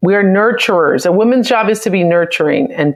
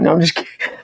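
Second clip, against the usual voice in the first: normal talking voice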